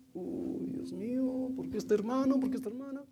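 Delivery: high-pitched pleading lament; mock exasperation. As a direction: whine to God in a high, put-on voice. Acts out exasperated thoughts like Moses. (lamento fingido y agudo)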